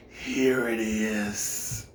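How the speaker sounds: put-on very slow, low, soft old man's drawl; creepy old man. The old landlord greeting LaDon, a slow low creepy voice. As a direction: creep out the visitor.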